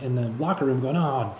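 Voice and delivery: ordinary talk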